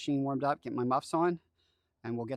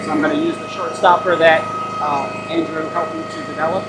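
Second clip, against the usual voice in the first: shouting over machinery; 2 semitones higher, 15 dB louder than usual. Real, not acted. Talking over the running log splitter.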